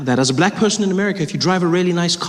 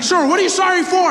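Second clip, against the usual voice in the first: nervous officer; 12 semitones higher than usual. Retold or imitated.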